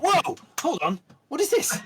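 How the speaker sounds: loud, very high mock shock; exaggerated astonished outburst; crew's shock. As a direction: act out a startled crew, loud and high. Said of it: "They all went".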